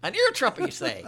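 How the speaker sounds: high, loud hard-of-hearing act; mock hard of hearing. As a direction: play someone who can't hear a thing.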